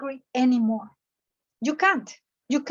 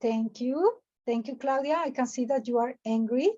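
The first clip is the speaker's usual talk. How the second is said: peaceful; 2 semitones lower, 3 dB quieter than usual. Acted. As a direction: soothe an angry client evenly. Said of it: "asking me peaceful".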